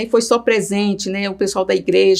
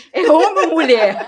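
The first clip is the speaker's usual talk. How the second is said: laughing question; 9 semitones higher than usual. Retold or imitated. Retelling asking the nurse about the baby, laughing. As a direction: giggle while asking the question.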